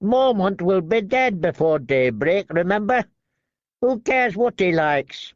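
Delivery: thin sharp man mocking; Lark sneering. A narrator's reading.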